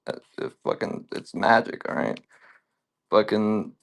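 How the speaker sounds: ordinary talk, nothing marked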